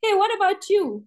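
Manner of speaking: high, lively tossed-back question; man turning question back. Acted. Kabuliwala turns the joke back on the little girl, high and lively. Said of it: "So he would say".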